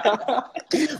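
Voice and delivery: high delighted laugh; real high-pitched laugh. Real, not acted. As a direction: burst out laughing, pleased. Laughs at being called young.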